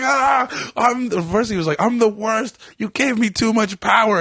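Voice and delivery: high, hammed-up tearful wail; mock crying. Imitating his overwhelmed friend on mushrooms, high mock sobbing. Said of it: "and he just cried".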